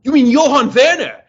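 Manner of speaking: high, loud mock German query; German accent. German racing team correcting his name, loud German accent. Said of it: "And they're like".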